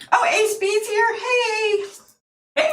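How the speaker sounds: light up as a friend joins the stream; excited greeting. Real, not acted. Greeting a viewer arriving live.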